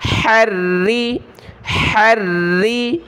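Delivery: हर्री with the ha from the throat; throaty ha. Shown as a demonstration. Teacher models the throat ha in हर्री. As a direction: push the ha of हर्री from the throat, slowly. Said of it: "हा को गले से निकालें।".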